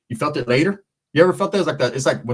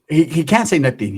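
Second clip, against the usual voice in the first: normal talking voice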